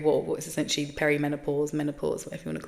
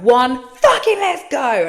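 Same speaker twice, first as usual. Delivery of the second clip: loud, slow burst of temper after counting to one; mock explosion; 7 semitones higher, 13 dB louder, slower than usual. To viewers, showing how fast she loses her temper, loud. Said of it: "I'm just like".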